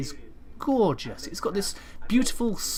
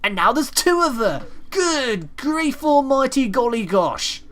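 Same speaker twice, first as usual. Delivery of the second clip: high, loud, slow mock outrage; over-the-top shocked exclamations; hammed-up dismay; 8 semitones higher, 6 dB louder, slower than usual. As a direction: play it like a scandalised old dear. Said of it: "that was said with great emotion".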